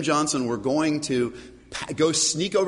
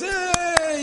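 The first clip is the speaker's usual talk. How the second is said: high, bright cheer of a whole crowd; mock crowd cheer; 12 semitones higher than usual. Pastor imitates the congregation cheering, high yay.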